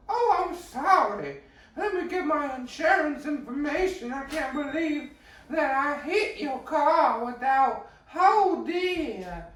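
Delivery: very high, loud, slow mock granny voice; squeaky hammed-up granny fretting over the crash; flustered old lady. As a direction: play a fluttery old lady in a tizzy. Old lady apologizing in retold crash.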